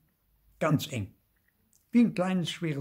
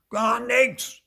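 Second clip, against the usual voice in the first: put-on high, loud child's shout; shouted, worked-up denial; angry yell; 12 semitones higher, 6 dB louder than usual. A narrator's reading. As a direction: yell it to shut them out. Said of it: "schreit Theo".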